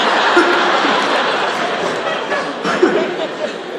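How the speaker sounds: high, breathy burst of laughter; loud laughter from the stage; big laugh. Real, not acted. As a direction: laugh hard at the goat joke. Laughing at own joke.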